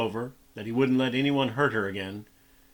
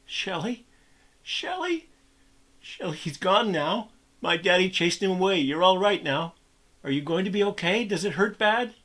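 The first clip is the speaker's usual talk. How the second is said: put-on higher, tearful boy calling gently; 7 semitones higher than usual. A narrator's reading. Boy calling to the beaten girl, tearful and worried. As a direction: call to her through tears. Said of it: "he called out to her through his own tears".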